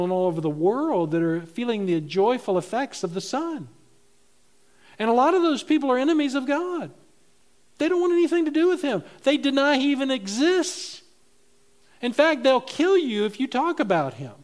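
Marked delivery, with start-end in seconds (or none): 5.0-14.3 high, loud, driving preacher's build; fiery sermon peak; preaching loudly. Real, not acted. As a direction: preach it hard, fast at first. Preacher rising to full force.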